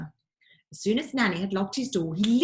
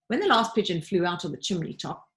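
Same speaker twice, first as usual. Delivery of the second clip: plain reading voice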